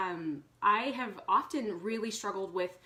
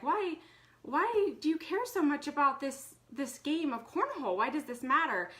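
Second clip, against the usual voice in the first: retold exasperated questioning, pitched higher; frustrated; 7 semitones higher than usual. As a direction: replay the exasperation at a son fussing over a game. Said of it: "I was kind of frustrated".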